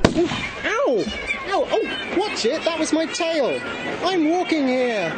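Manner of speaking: high yelping cat whose tail was stepped on; high, outraged talking cat; indignant cat yelp. Acted. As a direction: yelp and complain like a trampled cat. Cat trodden on in the crowd.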